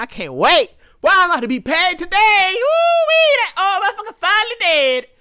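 high, loud, whooping glee at an inheritance; gleeful pretend heir. A gleeful heir cheering at his father's death, loud and high. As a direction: crow with joy over the payout.

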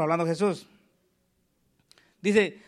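ordinary talk, nothing marked

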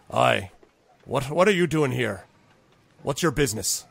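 mild gruff challenge from a dwarf; challenging dwarf. Acted. Dwarf at the door questions a stranger, mildly challenging.

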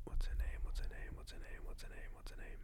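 whispered, fading to almost nothing; muttered to herself. Real, not acted. Trying to recall a YouTuber's name, whispered to herself. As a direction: repeat it under the breath while searching.

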